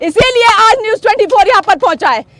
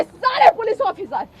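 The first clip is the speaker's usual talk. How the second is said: usual speaking voice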